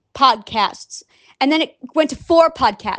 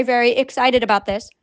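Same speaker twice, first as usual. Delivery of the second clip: normal talking voice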